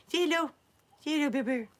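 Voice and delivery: high-pitched coaxing coo. Real, not acted. Owner asks Bear Bear to say hello, baby talk. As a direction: coax a small dog to greet people. Coaxing dog to greet viewers.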